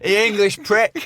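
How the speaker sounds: jeering heckler from the Welsh crowd; mock heckler shout. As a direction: yell it like a hostile heckler. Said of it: "the heckler dreaded".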